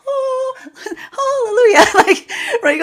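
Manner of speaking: high, laughing cry of relief; relieved hallelujah. Acted. Viewer finally hearing she's not at fault, laughing relief. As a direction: squeal it out like a weight just lifted. Acted relief of being validated.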